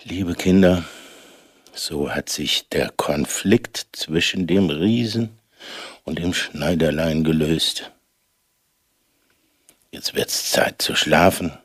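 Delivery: tuck them in gently; bedtime story voice. Acted. Goodnight to children.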